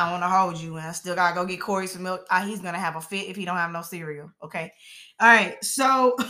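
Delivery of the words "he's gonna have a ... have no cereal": usual speaking voice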